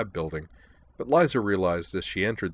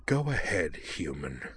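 hissed threat; 2 semitones lower, 5 dB quieter, slower than usual. Acted. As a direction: hiss it like a predator. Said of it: "Amanda hissed".

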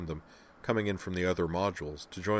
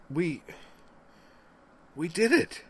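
stunned excitement; 11 semitones higher, 4 dB louder than usual. Acted. Crew realizes they won.

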